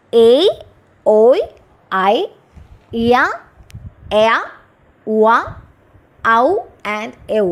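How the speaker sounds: എ, ഓയ്, ഐ each said alone as a gliding vowel; diphthongs sounded out. Shown as a demonstration. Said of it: "എട്ട് diphthongs ആണുള്ളത്".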